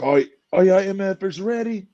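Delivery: mock arena hype yell; imitated yell. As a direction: call it out like a hype man on the mic. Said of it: "the way he yells".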